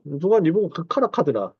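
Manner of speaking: imitated Gyeongsang dialect retort; Gyeongsang-do intonation, lightly imitated; Busan dialect. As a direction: quote it in a Busan accent. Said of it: "경상도 말로, 부산 말로".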